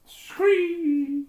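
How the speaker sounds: very high loud mechanical screech; robot monster screech, high and loud; shrill robot screech. Acted. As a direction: let out a piercing alien scream. Robot creature cry.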